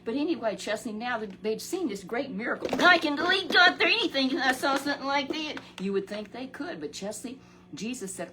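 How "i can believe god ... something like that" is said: voicing the awed disciples, much higher and louder; excited exclaim. Disciples marvel after the loaves, loud and high. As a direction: burst out with wonder at the miracle.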